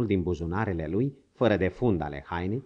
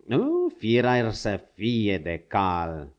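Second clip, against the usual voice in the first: ordinary delivery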